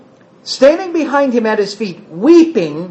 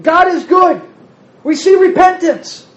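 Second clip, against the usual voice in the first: higher, brighter voice quoting the mother; mother's joyful message; 8 semitones higher than usual. Retold or imitated. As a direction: voice Mom's cheerful message. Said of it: "an email from my mother".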